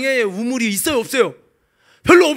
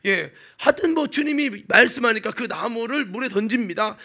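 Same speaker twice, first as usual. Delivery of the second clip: ordinary delivery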